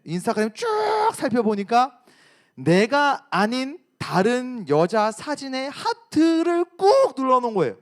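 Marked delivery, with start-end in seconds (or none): none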